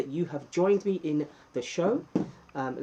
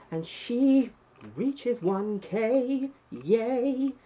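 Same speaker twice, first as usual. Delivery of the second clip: high, bright-eyed whoop for the milestone; excited cheer; 9 semitones higher, 4 dB louder, much slower than usual. Real, not acted. Host cheering his wife's channel toward one K, excited.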